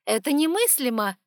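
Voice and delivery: loud, high outraged cry; unthinkable, spit it out. Acted. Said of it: "возмутилась Эванс".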